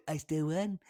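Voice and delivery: a soft, low take on Trump; Trump impression. Host imitating Trump claiming victory, soft low mimicry. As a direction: lightly mimic Trump insisting he won. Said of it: "Trump said".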